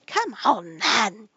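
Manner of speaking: high, very loud roar; roaring queen. A narrator's reading. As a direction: roar the summons. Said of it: "roared the Queen".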